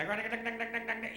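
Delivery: high, loud engine clatter; imitated revving engine. Driver revving the faulty engine, imitated racket. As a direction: mimic an engine racing with rapid dings. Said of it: "hear a funny noise in the engine".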